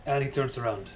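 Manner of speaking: normal talking voice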